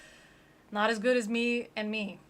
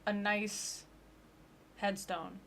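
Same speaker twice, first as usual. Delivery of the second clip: ordinary talk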